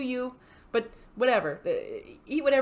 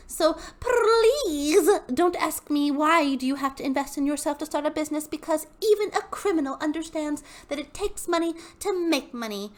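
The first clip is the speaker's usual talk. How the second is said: higher, preachy sales-pitch voice; Hunbot voice; 7 semitones higher, 4 dB louder than usual. Acted. Reading the hun's post aloud, in a smug higher sales voice. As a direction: read it like a smug MLM hun. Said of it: "the Hunbot voice".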